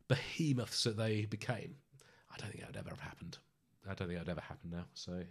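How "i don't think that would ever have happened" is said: fast hushed whisper. Real, not acted. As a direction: breathe it out as a quick aside. Dropping to a private aside.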